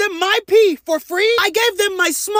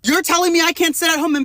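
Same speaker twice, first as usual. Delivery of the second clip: ordinary talk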